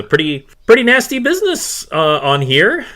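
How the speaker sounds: high, loud, quick character voice; animated captain character voice; ship captain remarking. Acted. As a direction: pitch it up as the chatty captain. Game master plays Captain Jackson.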